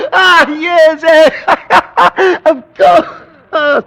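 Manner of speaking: high, loud nervous laughter through the words; shrill-pitched manic laugh around a forced agreement. Acted. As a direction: laugh it off wildly, barely in control. Hysterical laugh at diagnosis.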